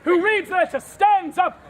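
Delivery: normal talking voice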